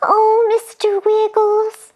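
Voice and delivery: worried sister; high worried angel. Acted. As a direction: gasp it in alarm. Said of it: "said Angel Scarlet".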